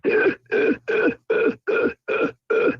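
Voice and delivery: high breathless wheezing laugh, imitating Marcus; mock Marcus cackle, airy and high; a hammed-up imitation of a man's giggle fit. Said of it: "do that imitation of Marcus".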